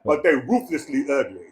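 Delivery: usual speaking voice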